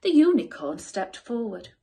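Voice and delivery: usual speaking voice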